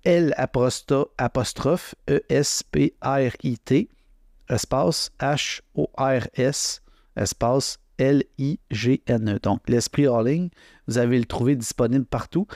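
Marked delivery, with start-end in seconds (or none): 0.0-9.4 slow, letter-by-letter dictation; spelling out. Shown as a demonstration. Podcaster spelling a book title for listeners, slow spelling out. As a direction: spell it slowly with spaces between the letters. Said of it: "vous le, l'épeler".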